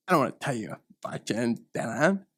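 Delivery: ordinary talk, nothing marked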